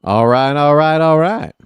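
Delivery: easygoing catchphrase, played up for the booth. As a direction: slide through each all right like a laid-back movie stoner. Echoes the Dazed and Confused clip.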